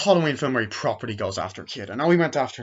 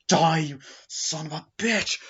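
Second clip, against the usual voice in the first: slow, drawn-out mock fury; dragged-out final-girl threat; defiant snarl; 4 semitones higher than usual. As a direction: spit it at the killer. Heroine fights off Michael.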